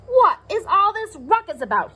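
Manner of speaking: a hint of a stern officer asking; stern policeman. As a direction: policeman demanding an answer about the noise, lightly stern. Policeman demands explanation.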